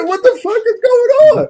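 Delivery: very high shocked shriek; shrieked disbelief. Retold or imitated. Retelling the theater moment, a very high shriek. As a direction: squeal it out in total disbelief. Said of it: "I said".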